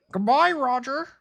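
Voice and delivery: pitched high, rising goodbye; high squeaky voice. Acted. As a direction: squeak it like a tiny creature. Tattoo voice says goodbye.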